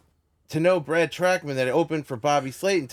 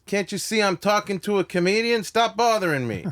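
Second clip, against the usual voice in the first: Andrew Dice Clay; 7 semitones higher than usual. Retold or imitated. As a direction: act out an annoyed comic telling off fans. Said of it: "it's Andrew Dice Clay".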